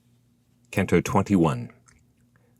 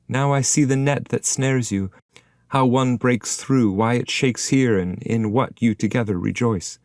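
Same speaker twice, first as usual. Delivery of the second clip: put-on higher, measured explanation from the old poet; voiced guide; 7 semitones higher, 3 dB louder than usual. A narrator's reading. Guide answers the spirit.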